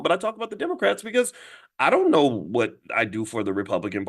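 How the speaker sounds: usual speaking voice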